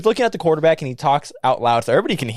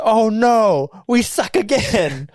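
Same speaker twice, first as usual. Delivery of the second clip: high, louder mock wail, drawn out; mock fan's lament; 8 semitones higher, 3 dB louder, much slower than usual. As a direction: wail it slowly like a let-down fan. Mimicking a football fan's lament.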